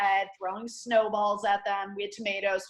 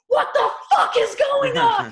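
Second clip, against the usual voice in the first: a shocked mom yelling, much higher and louder; furious shout; 9 dB louder than usual. Retold or imitated. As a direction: scream it in total disbelief. Mom discovers the hazing.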